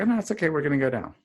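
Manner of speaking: high, light reassuring coax, playfully retold; mock reassuring. Doctor coaxing the nervous intern downstairs, high mock reassuring. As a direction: coax him along, a touch hammed-up.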